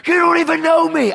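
high, loud protest; retold screaming. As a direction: imitate a hurt man shouting back. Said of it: "yelling at me and screaming".

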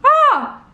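loud, high "ah!"; sharp high yelp. Real, not acted. Nervous reaction before the chilli shot.

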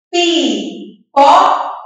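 "Pipoca" slowly, as pi, po, ca; syllables sounded out. Shown as a demonstration. Teacher showing the sounds, "Pipoca" in three separate syllables. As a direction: leave a gap after each syllable of "Pipoca". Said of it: "Olha a sonorização".

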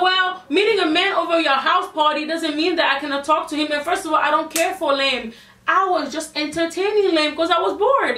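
high-pitched, animated protest; acted-out indignant self-defence; retold defensive retort. Retelling her own defensive retort.

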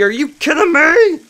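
high-pitched exasperated disbelief; indignant, high-pitched incredulity; incredulous. Real, not acted. Called in to work at night.

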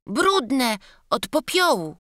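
put-on high, timid childlike apology; a scared little boy stammering out his excuse. Boy answering.

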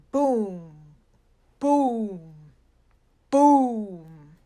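mock explosion booms growing to a roar; building booms. A narrator's reading. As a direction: boom like an explosion getting bigger. Explosion sounds in story.

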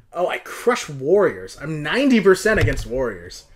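ordinary talk

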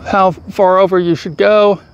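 usual speaking voice

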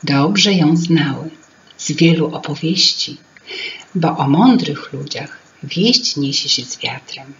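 ordinary delivery, nothing marked

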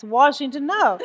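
amused emphatic no; laughing. Real, not acted. Laughing punchline.

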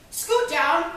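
loud mock examiner's orders; brisk examiner. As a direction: boss the patient around at full volume. Mimics examiner at gynecological exam.

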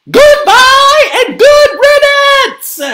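high, loud, slow send-off; shouting. A narrator's reading. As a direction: shout them out of the house. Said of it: "shouted the Torkelsons".

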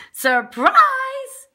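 high, loud, drawn-out cry; excited surprise. A narrator's reading. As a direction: burst out with a gleeful reveal. Snow dude springs to life.

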